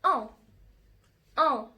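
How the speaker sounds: "ان" said as a nasal French vowel. Shown as a demonstration. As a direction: sound "ان" as French "on", through the nose. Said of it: "ان تاني بتقطع ايه".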